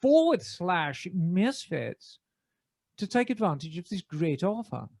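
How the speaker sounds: measured BBC announcer reading an ad. Acted. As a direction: deliver the sponsor read in your best BBC British. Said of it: "in my best B B C voice".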